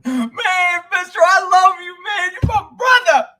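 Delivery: high, loud, slurry weeping; drunk crying. Pretend. Host mocks himself as a crying drunk to viewers, high loud blubbering. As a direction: sob out brotherly love like a sloppy drunk. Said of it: "Somebody's already drunk and crying".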